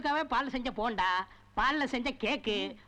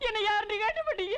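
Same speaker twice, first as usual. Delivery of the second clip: high-pitched wail; crying; 7 semitones higher than usual. Acted. Relative hurt at being asked who he is, crying. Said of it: "அழாத, அழாத".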